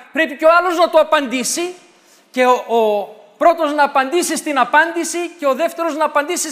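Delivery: loud, high, building preacher's voice; preaching at full force. Acted. Preacher urging the faithful not to quarrel, loud and high. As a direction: drive the point home to the congregation.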